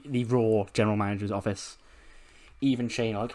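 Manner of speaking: ordinary talk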